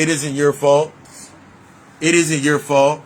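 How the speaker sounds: usual speaking voice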